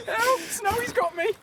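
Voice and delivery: high, slow squeal for help; mock cry for help. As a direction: squeal it like a panicked victim.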